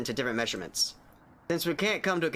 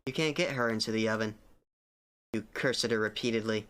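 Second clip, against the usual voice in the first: ordinary talk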